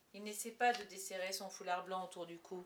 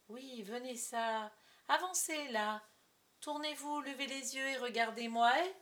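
higher, theatrical commanding voice; stage father giving orders; 4 semitones higher, 4 dB louder than usual. Acted. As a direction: summon the child imperiously. Molière's line in the play.

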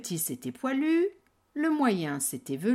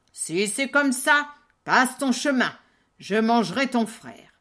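loud gruff troll; 3 semitones higher, 6 dB louder than usual. Acted. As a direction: dismiss him like a troll. Troll lets goat pass.

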